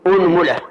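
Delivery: أنملة with u then mu, unmula; أنملة, both vowels rounded, unmula; unmula with damma on mim. Shown as a demonstration. Teacher lists the third variant for the class, unmula. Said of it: "فنقول".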